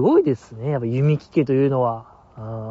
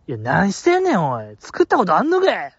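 imitating a sister's pushy Kansai-dialect scolding; strong Kansai dialect; 2 semitones higher than usual. Little sister scolding Yumiki over the hotplate cooking, in strong Kansai dialect. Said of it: "なんか結構強めの関西弁で".

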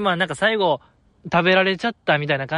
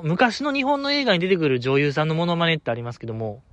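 usual speaking voice

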